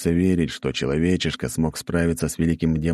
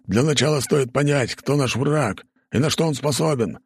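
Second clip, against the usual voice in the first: higher, insistent lord voice; urgent demon lord; 9 semitones higher than usual. Acted. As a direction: press for a scouting mission. Lord continues argument.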